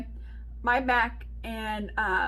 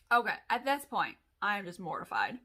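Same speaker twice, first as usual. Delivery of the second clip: ordinary talk, nothing marked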